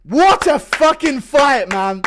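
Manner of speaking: excited. Real, not acted. Stunned after the knockdown.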